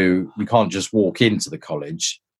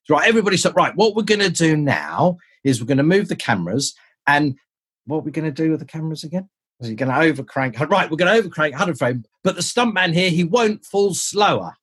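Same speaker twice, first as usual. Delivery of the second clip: high-pitched imitation of a director; director addressing crew; 7 semitones higher than usual. Director stops the entire crew to give orders, announcing. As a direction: announce it to everyone, pitched well up.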